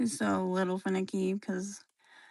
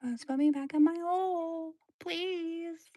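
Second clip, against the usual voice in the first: small higher pet voice pleading; 7 semitones higher than usual. Pretend. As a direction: beg to be put back, pitched up.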